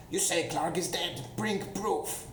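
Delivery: high, menacing goblin voice; second goblin voice. Acted. Goblin in the cave bargaining with the party, high and menacing. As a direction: voice a sneering goblin guard. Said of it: "another voice... that says".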